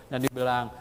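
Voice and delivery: ordinary talk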